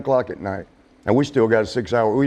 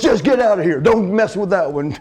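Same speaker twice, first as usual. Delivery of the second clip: angry dismissal; 7 semitones higher than usual. Retold or imitated. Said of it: "when the next one goes".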